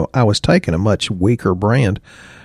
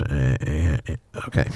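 imitating a blocked nose, lower and softer; sniffly, congested mock talk; stuffed-up nose voice; 5 semitones lower, 7 dB quieter than usual. Mimicking sinus customers who can't breathe, congested. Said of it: "and they talk like this".

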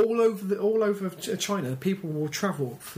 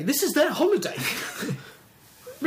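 laughing through the words; amused disbelief, giggling; 9 semitones higher, much slower than usual. Real, not acted. Laughing at power station tourism.

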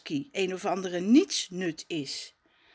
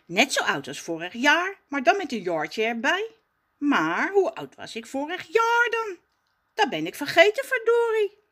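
put-on much higher, puzzled child voice; pondering boy; 8 semitones higher, 4 dB louder than usual. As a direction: wonder aloud, puzzled. Raf puzzling aloud.

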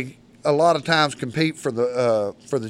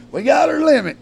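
high, louder triumphant news; retold excited boast; excited announcement; 13 semitones higher, 5 dB louder than usual. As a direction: crow it proudly, pitched well up.

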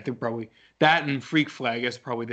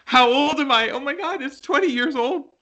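shocked; 12 semitones higher, 5 dB louder than usual. Real, not acted. Shock at movie's age.

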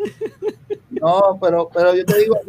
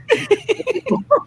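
laughter. Real, not acted. Laughing at own punchline.

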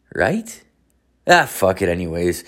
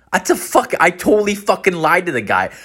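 normal talking voice

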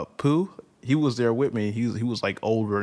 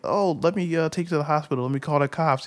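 lightly higher helpful stranger; concerned lady; 6 semitones higher than usual. Retold or imitated. Lady offering hospital and cops, fussing.

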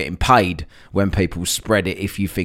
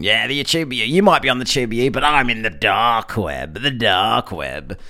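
smug conspiracy-type voice, slowing down; mocking know-it-all. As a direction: sneer it like a smug insider. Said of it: "where these people get it".

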